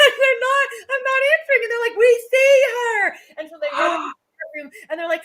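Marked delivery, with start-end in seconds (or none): 2.0-3.1 loud, higher, slow cry of discovery; excited kids' shout. Retold or imitated. Kids shouting through the window, loud and high. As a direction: shout it like you just spotted her. Kids spotting teacher on floor.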